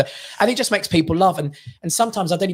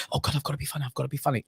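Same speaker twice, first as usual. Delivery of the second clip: muttered worried self-talk; 10 dB quieter, faster than usual. Pretend. Comic's imagined nerves before walking on, muttered softly. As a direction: fret to yourself, barely audible. Voices anxious thought before going on.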